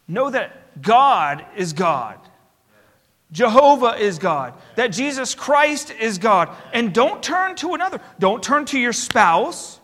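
preacher urging the flock to turn to God only; preaching. Acted. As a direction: build the call up line by line. Preacher building to full force.

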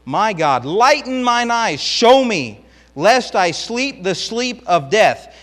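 preacher reading David's desperate prayer aloud; pleading with God. Acted. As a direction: plead for your life before God. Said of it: "he's still pleading with God".

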